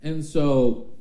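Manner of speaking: normal talking voice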